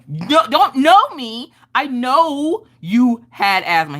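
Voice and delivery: high, slow, emphatic retort; mock-outraged arguing, drawn out; indignant insistence. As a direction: stretch out each accusing word at dad. Acted quarrel with her dad.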